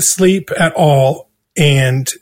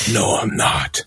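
breathy lower denial; whispered denial; 8 semitones lower, 7 dB quieter than usual. Retold or imitated. To the accountant, a hushed whispered denial. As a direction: whisper it like a kid caught out.

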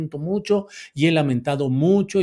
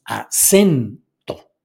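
"acento" slowly split, SEN louder and drawn out; stress on SEN; 8 dB louder, much slower than usual. Shown as a demonstration. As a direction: lean hard on the middle syllable of "acento". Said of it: "el acento prosódico".

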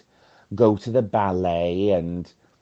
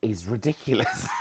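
ordinary talk

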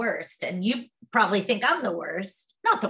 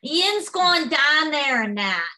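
Pittsburgh twang; 3 semitones higher, slower than usual. Retold or imitated. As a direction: ham up the husband's yinzer twang. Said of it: "they just have this twang".

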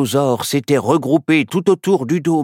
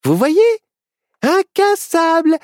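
high, gloating little witch; proud exclamation; 4 dB louder than usual. A narrator's reading. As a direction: crow "Incassable" proudly, drawing it out. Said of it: "s'exclama Myrtille fièrement".

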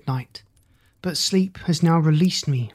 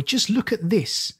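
put-on boy's cry; 6 semitones higher than usual. A narrator's reading. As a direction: show it off in a boy's higher voice. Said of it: "cried the boy".

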